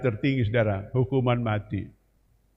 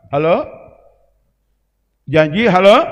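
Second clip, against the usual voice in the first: loud call; 3 semitones higher, 11 dB louder than usual. Real, not acted. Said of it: "calling congregation".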